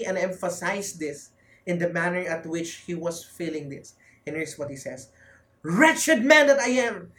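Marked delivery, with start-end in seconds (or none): 5.6-7.1 high, loud and slow, full of self-reproach; slow anguished cry; frustrated anguish. Acted. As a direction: cry it out like a man disgusted with himself. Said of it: "He was so frustrated with himself".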